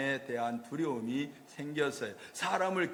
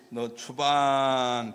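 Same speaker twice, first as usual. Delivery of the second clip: slow, low, heavy decree; stern divine verdict; 4 semitones lower, 3 dB louder, much slower than usual. Retold or imitated. God to Adam banishing him, slow stern decree. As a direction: pronounce the banishment like a judge.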